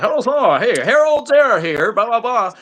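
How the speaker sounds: hammed-up, chirpy on-air patter; corny newscaster. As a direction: imitate a corny TV presenter's hello. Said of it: "these two corny ass people".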